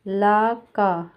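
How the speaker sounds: ला का drawn out slowly; syllable by syllable. Shown as a demonstration. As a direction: sound out ला then का, slowly. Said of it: "ला के बाद ए".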